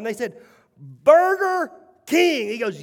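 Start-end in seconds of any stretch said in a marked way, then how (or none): 0.8-2.5 high, slow, stretched-out shout of the name; loud shout. Retold or imitated. Executives shouting the name outside at the guru's request, loud and slow. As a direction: belt the brand name at full volume. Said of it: "Now let's say it one more time".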